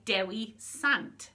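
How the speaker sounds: Dewi Sant slowed, DEH-wee SANT, each word spaced; Welsh Dewi Sant. Shown as a demonstration. [name] teaching Saint David in Welsh, slow clear demo. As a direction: spell it out slowly with your voice. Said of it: "to say Saint David, you say".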